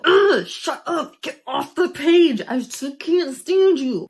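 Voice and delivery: a reader yelling at a character she hates, slow; yelling at the book. Retold or imitated. Reader yelling at the audiobook: hammed-up angry shout. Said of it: "I was just like".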